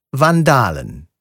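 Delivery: ordinary talk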